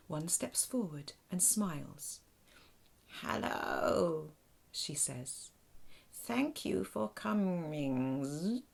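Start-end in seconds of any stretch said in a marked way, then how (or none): 3.2-4.3 buzzing alien voice through a translator; creaky, awkward machine-like voice; creaky robot alien. Acted. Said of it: "her voice is rather creaky and awkward, with a buzz to it". 6.1-8.6 slow, buzzing translated alien voice; creaky robot alien. Acted. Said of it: "her voice is rather creaky and awkward, with a buzz to it".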